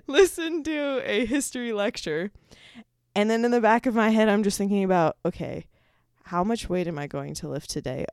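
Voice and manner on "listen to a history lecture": high-pitched, laughing through the words. Real, not acted. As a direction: talk through a fit of giggles. Laughing at her own slip.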